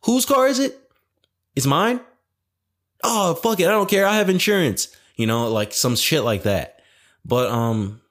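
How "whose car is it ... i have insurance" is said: much higher, excited yelling voice; high-pitched, hammed-up riot hype; hyped-up rioter. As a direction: act out a rowdy fan caught up in the moment. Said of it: "in the moment when it's happening, you're just like".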